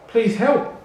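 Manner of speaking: high, loud desperate plea; panicked cry. Acted. A drowning cheetah begging the lion for help, panicked. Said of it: "cried the cheetah in panic".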